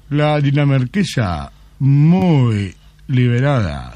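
very slow, savouring verdict; pause, then drag out the praise; slow Japanese man. Acted. Japanese lover judging women for the interviewer, very slow.

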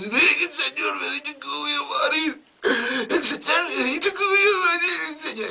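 comic high-pitched blubbering; hammed-up wailing. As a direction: wail it like a hysterical maid. Maid crying that master said so.

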